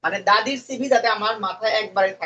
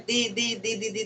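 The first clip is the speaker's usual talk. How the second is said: monotonous repetition of দি; 8 dB quieter than usual. Shown as a demonstration. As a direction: rattle off দি over and over. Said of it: "দি করে গেলেও". (একটানা দি দি বলে যাওয়া)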